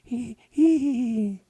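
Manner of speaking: high-pitched silly giggle; giggling hee hee. Acted. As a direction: giggle like a lovestruck muskrat. Lovestruck Muskrat giggles.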